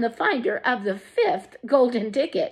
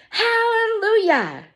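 high, loud, slow cheer; yelled; 13 semitones higher, 3 dB louder, slower than usual. A narrator's reading. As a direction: belt it out in triumph. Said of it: "yelled Grandpa Joe".

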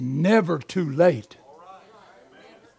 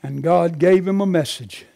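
usual speaking voice

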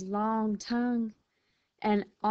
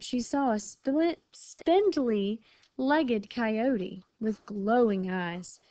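usual speaking voice

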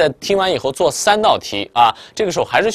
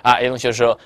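ordinary talk, nothing marked

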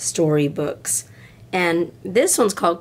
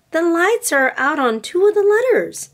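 put-on little girl calling attention, higher; little girl Lisa; 10 semitones higher than usual. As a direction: stretch it out like a curious child. Lisa voiced by reader.

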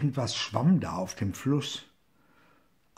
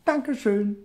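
higher, louder cheeky thanks; calling out; 10 semitones higher, 4 dB louder than usual. A narrator's reading. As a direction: call back triumphantly. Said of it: "rief er". (hoher, lauter, frecher Dank)

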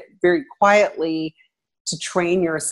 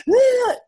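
exasperated groan. Retold or imitated. Exasperated mom reaction.

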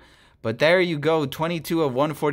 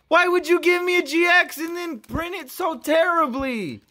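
pitched way up, whining at the card; high upset whine; 11 semitones higher than usual. Real, not acted. Collector whining high at a miscut card.

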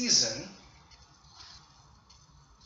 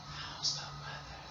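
whispered prompt; 16 dB quieter than usual. Shown as a demonstration. Teacher whispering the student's line to prompt them.

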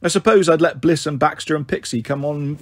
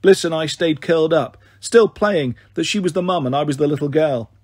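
usual speaking voice

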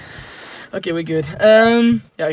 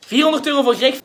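auctioneer; 5 semitones higher than usual. Acted. Auction bidding at Monopoly.

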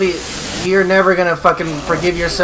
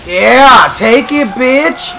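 loud, high battle yell; triumphant taunting shout; 7 semitones higher, 9 dB louder, much slower than usual. Real, not acted. Gamer landing hits on the boss, taunting shout. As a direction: shout it at the enemy as you strike.

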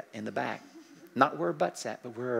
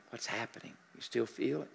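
soft check-in; 3 semitones lower, 9 dB quieter than usual. Shown as a demonstration. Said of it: "every once in a while I check in".

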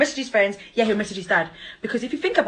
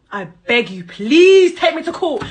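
taunting plea; 4 semitones higher, 6 dB louder, slower than usual. Real, not acted. Sarcastically daring him to sue.

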